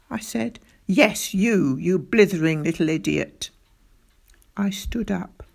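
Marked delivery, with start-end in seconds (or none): 0.8-3.5 stern teacher's insult, falling low; angry scolding. A narrator's reading. Captain berating pupil, angry scolding. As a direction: berate the pupil with mild force. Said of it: "he shouted".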